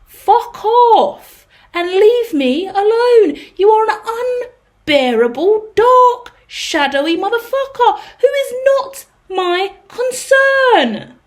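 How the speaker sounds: slow, loud, seething dismissal; a rising, tearful scream of rejection; furious outburst. Acted. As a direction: spit each word out in slow fury. Tarot reader voicing the querent's rage.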